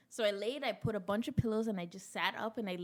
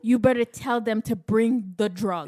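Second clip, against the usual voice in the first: louder mock demand; mock threat; 9 dB louder than usual. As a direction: lay down the law to him. Grabbing husband by the neck.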